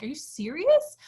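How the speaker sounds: high, incredulous question, rising at the end; freaking out. Retold or imitated. Daughter to dad over costly chairs, freaking out. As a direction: act out disbelief, voice jumping up. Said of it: "I was, like, freaking out".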